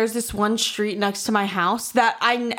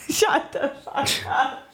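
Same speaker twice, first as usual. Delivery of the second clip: laughing; 4 semitones higher, slower than usual. Real, not acted. Laughing at friend's joke.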